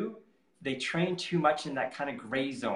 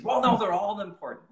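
higher, louder imitated objection; protesting athlete; 7 semitones higher, 5 dB louder than usual. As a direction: object like an insistent athlete, pitched up. Voices athletes objecting to advice.

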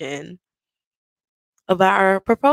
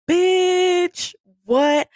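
high shocked; 11 semitones higher than usual. Retold or imitated. Own shocked reaction voiced.

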